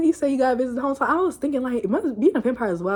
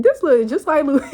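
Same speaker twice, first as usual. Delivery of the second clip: louder, higher, breaking into laughter; laughing exclamation; cracking up; 4 semitones higher, 5 dB louder than usual. Real, not acted. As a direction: blurt it out as the laugh takes over. Joking reaction, breaking into laughter.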